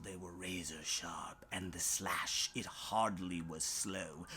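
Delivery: hushed low suspense; hushed menace. A narrator's reading. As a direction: drop to a tense hush. Claws slash, hushed menace.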